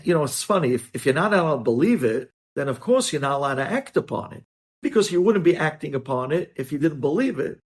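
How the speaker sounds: ordinary talk, nothing marked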